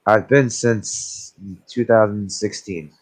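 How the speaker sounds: ordinary talk, nothing marked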